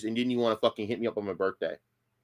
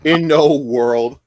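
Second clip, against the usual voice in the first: In no world dragged slow and loud; mock catchphrase; 11 dB louder, much slower than usual. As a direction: draw out "In no world" in loud mockery. Streamer repeats catchphrase mockingly.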